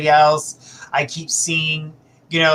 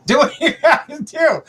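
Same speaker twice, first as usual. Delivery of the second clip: higher, a laugh breaking the line; laughing through it; 9 semitones higher than usual. Real, not acted. Reader laughing while telling client to cut him off. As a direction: giggle mid-advice.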